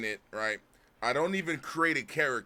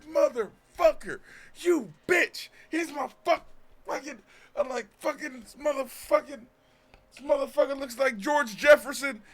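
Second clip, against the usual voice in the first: cursing much higher and louder; retold furious yelling; 13 semitones higher, 6 dB louder than usual. Insulting the game character on seeing him, enraged yelling. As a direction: spit the insults at the screen.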